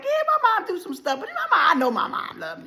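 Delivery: high, laughing brush-off; laughing reply. Retold or imitated. Answering gossipers about her mom, laughing. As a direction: laugh it off lightly.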